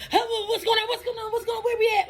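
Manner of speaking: startled awake. Retold or imitated. Dozing instructor jolts awake in the car, startled and confused. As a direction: blurt it like you just woke mid-drive. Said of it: "wake up in a sense of urgency".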